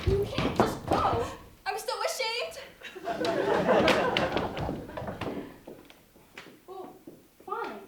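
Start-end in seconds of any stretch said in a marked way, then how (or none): 2.6-5.7 an airless, falling laugh; whispered falling laugh; breathy laugh. Acted. Breathy laugh after shame line.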